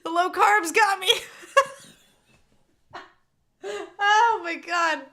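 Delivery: high, loud tearful wail breaking into laughter; crying and laughing. Real, not acted. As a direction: blame it through sobs and giggles. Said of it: "crying and laughing".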